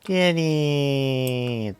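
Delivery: loud, high excited call. Real, not acted. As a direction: call the kitty happily. Calling out to a cat.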